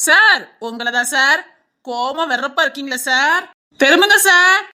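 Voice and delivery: drawn-out, pestering call for attention; insistent, mock-polite heckling; taunting call. Taunting man calls out to passenger.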